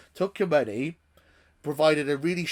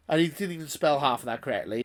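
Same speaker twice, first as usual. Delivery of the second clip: ordinary talk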